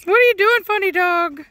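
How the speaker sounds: high, loud playful pet voice; teasing a funny dog in pet talk. Real, not acted. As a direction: coo at the dog in a sing-song.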